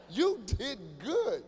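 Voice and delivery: You did good drawn out very slowly; slow sarcastic praise; slow emphatic repeat. Shown as a demonstration. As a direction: stretch "You did good" as a mock compliment.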